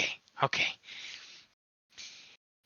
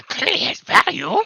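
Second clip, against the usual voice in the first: high, breathless goblin whisper; sneaky goblin whispering to his friend; 8 dB louder than usual. Acted. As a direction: hiss it secretly to the others. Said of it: "whispering that in Goblin".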